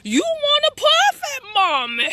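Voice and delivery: high, loud mocking taunt; mother teasing her angry son; teasing mom. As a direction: taunt him like he's a tough little dog. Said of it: "and I was like".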